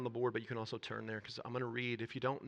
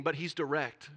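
usual speaking voice

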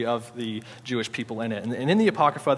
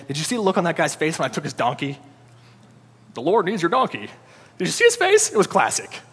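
giddy high-fiving disciples, voice jumping high; excited gloating; 4 semitones higher, 4 dB louder than usual. Acted. Disciples bragging to each other, excited. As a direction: crow about the prank like a thrilled friend.

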